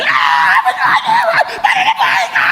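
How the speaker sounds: hammed-up despairing wail; hopeless wailing. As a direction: cry out like someone grieving with no hope. Mimicking hopeless mourner wailing.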